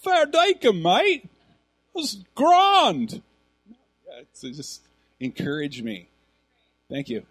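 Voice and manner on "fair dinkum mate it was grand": hammed-up Aussie, high and loud; mock Australian accent. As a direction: overdo a put-on Australian accent. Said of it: "He was Australian. Could you tell?".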